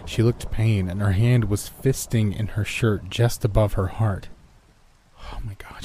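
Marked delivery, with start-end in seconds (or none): 5.3-5.8 hushed, airy gasp of a thought; alarmed whisper. A narrator's reading. Roommate silently fearing a heart attack, whispered. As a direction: breathe it out under your breath.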